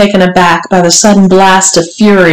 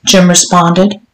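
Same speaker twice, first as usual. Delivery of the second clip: ordinary delivery, nothing marked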